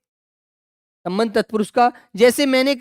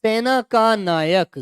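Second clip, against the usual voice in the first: सेना का नायक said slowly, each word spaced apart for the pupils; slow dictation; much slower than usual. Shown as a demonstration. Teacher to pupils, splitting the compound, slow and deliberate. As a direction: spell the split out slowly so the children can follow. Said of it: "इसको तोड़ दीजिए".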